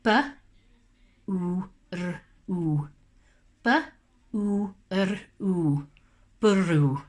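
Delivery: letter by letter B, U, R, U, then Bwrw; sounding out words. Shown as a demonstration. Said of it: "Let's sound it out together".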